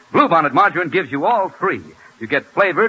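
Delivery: usual speaking voice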